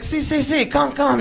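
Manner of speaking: high, eager Spanish-speaking host; welcoming shop man. Retold or imitated. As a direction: usher them in conspiratorially. Shop man eagerly invites them in.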